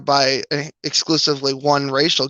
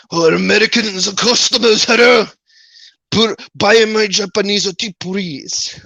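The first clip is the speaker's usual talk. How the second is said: mock Japanese accent; 4 semitones higher than usual. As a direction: play a cheesy Japanese tea vendor calling to customers. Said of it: "I think I probably do Japanese accents better".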